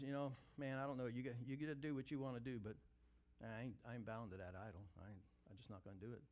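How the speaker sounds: very low, soft and quick, fading to a mumble; steady, under your breath; quiet refusal. Retold or imitated. Friend telling friends he won't bow, fading quiet.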